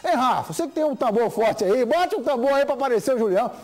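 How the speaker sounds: loud, high rallying appeal; rallying call. Real, not acted. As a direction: fire up the audience. Host rallies viewers, calling out.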